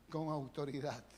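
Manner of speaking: "Con" stressed, falling and soft; soft emphatic answer. Shown as a demonstration. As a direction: land on "Con autoridad" quietly. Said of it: "¿Cómo era la palabra". ("Con" acentuado, en voz baja)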